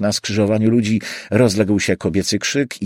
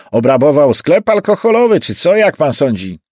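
louder, higher cabbie voice; nosy cabbie's raised voice; cab driver; 7 semitones higher, 6 dB louder than usual. Acted. Driver asking his passenger about the chase, raised cabbie voice.

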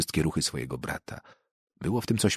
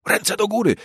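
put-on much higher, louder child's command; sharp yell; 4 dB louder than usual. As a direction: bark it like a kid playing soldier. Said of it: "wrzasnął ktoś ostro". (ostry okrzyk)

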